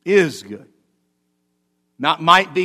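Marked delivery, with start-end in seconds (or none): 0.0-0.7 short, emphatic "is"; stress on IS. Shown as a demonstration. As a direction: punch "is", let "good" fall away. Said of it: "give it a little bit of umph".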